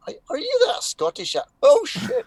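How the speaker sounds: very high mock squeak of recognition; startled local. Caught out in the village coffee shop, squeaky mock alarm. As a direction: rise into a loud, comic dismay.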